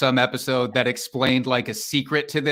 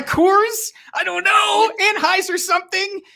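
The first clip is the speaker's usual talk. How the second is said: high unsure guessing; 4 dB louder than usual. Real, not acted. Unsure guess under time pressure.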